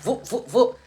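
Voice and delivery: playful whoosh noises; imitated speeding cars. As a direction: mimic cars zooming past. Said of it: "these cars was like".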